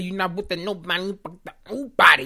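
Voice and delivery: a light [name] lilt, punching body; quoting [name]. Retold or imitated. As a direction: toss off the lyric like a Nicki line. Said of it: "she said".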